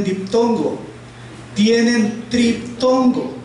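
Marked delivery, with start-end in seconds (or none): 1.5-3.3 "tienen triptongo" slowed right down, higher, in separate chunks; emphatic correction. Shown as a demonstration. Teacher correcting the workbook's diphthong label, slow emphatic correction. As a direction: stretch out "triptongo" to stress the correction.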